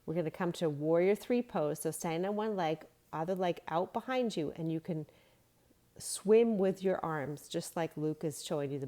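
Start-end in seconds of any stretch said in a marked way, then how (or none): none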